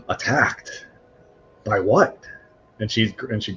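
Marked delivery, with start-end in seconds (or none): 0.0-2.2 gasping shock. Acted. Augra shocked at attack news.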